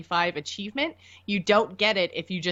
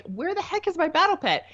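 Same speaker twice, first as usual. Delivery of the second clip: high, baffled indignant question; confused outrage; 11 semitones higher than usual. Retold or imitated. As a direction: demand it in disbelief. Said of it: "I'm like".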